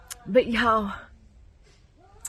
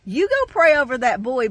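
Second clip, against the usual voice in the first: loud, higher-pitched retold order; raised-voice imitation of an insistent preacher; preacher's urgent command; 4 semitones higher, 7 dB louder than usual. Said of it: "grabs me and goes".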